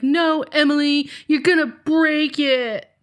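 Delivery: mock exasperated refusal; imitated protesting brother. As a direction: mimic a kid refusing to share. Mother voices brother refusing his sister.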